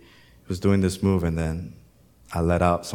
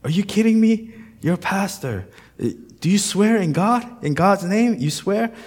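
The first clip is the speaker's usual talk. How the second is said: put-on much higher, louder incredulous kid; mock disbelief; 12 semitones higher, 5 dB louder than usual. Pastor voices Thomas challenging him to swear, high incredulous. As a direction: voice the young guy's outrage, hammed-up.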